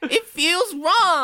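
very high, slow little creature voice; Twiggly's squeaky voice. Acted. Twiggly fretting over the sword, slow squeaky voice. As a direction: stretch it out high and thin. Twiggly keeps complaining in character.

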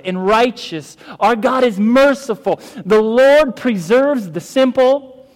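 loud, high, slow scripture proclamation; impassioned preaching. Acted. Pastor reading the psalmist's anguish to the congregation, impassioned preaching. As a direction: hammer out each weighty word to the room.

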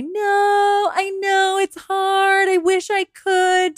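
high, slow, drawn-out pitiful voice; polite, self-pitying. Acted. Single person indulging someone's pity, politely woeful. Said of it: "politely say".

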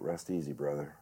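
softly, low, moved by the line; emotional. Real, not acted. Moved by recalling a line from the play, low and emotional. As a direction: say it quietly, letting it fall away. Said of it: "it'll make me emotional thinking about it".

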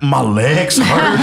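high, slow, hammed-up wail; mock crying. Patient answering the nurse through tears, hammed-up crying. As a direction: whine it out slowly like a crying patient. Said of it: "crying so much".

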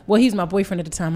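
ordinary talk

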